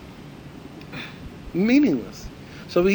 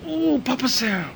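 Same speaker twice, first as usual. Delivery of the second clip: Chinese waiter voice; 12 semitones higher, slower than usual. Acted. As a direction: cry out for Mama Sam in broken English. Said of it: "the Chinese guy says".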